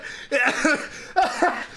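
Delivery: high wheezing laugh; helpless laughter. Real, not acted. Picturing the bag in the bin, helpless laughter. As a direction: laugh too hard to go on. Cracks up at own story.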